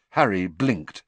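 plain reading voice

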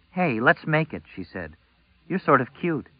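put-on a brazen young girl. A narrator's reading. Girl propositions Nick.

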